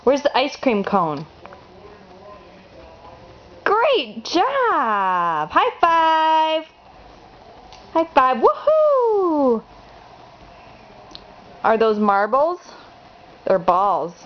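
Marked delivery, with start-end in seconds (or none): 3.6-8.4 excited cheer. Real, not acted. Cheering toddler on picture book. 8.4-9.6 excited woohoo. Real, not acted. Celebrating toddler's right answer.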